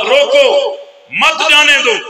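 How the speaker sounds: "रोको" on its own, pause, then "मत जाने दो"; pause after रोको. Shown as a demonstration. Watchman reading out the note his way, pause after रोको. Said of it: "वक़्फ़ को आगे पीछे करने से माना बदल जाता है".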